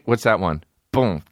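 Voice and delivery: ordinary talk, nothing marked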